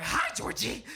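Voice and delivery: whispered creepy clown greeting. Retold or imitated. Stripper clown whispering to a scared straight guy. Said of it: "I'm like".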